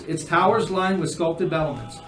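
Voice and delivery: atmospheric scene narration. Acted. Game master revealing a silent ruined house, atmospheric narration. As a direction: build atmosphere with pauses, then drop away.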